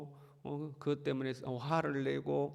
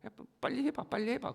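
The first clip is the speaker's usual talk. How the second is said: higher, needling "hurry up" jeer; impatient taunt; 9 semitones higher, 5 dB quieter than usual. Retold or imitated. 예레미야를 재촉하며 조롱하는 백성들, 높은 톤으로. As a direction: egg him on with a scoff. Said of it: "백성들이 조롱하는 거예요".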